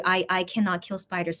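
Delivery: ordinary delivery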